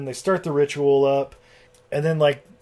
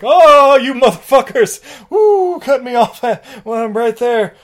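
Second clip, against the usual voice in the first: high, loud frustrated wail; hammed-up indignant yelling; Blackula in mock outrage; 10 semitones higher, 10 dB louder than usual. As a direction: howl it at those who interrupted. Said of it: "Blackula's like".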